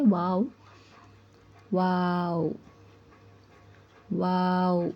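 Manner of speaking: वाव said with lips fully rounded, drawn out; rounded-lip waw. Shown as a demonstration. Quran teacher demonstrates the rounded-lip वाव to students. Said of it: "होंठ गोल होने से अदा होता है".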